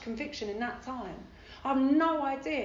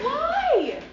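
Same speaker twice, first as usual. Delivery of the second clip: baffled; 8 dB louder than usual. Retold or imitated. Baffled at sticking around.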